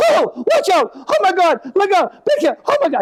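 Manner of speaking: hammed-up nervous passenger mom; panicky mother. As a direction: squeal out the warnings like a frightened old lady. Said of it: "she'd start this".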